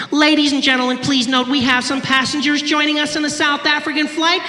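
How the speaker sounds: high, loud cabin-announcement voice; air hostess announcement. Acted. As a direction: imitate an air hostess on the microphone. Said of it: "takes her little microphone, she goes".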